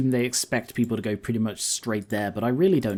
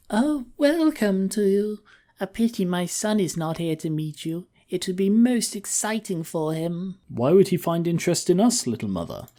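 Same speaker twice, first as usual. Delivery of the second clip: halfling mother voice; 6 semitones higher than usual. Acted. Voices halfling mother and his character.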